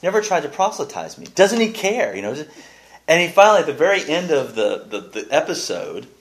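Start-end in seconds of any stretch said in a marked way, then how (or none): 0.0-2.1 higher, faster, mock-indignant Elaine. Elaine complaining her boyfriend never tried to convert her, mock-indignant and higher. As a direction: whine it as an offended girlfriend. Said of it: "and she's".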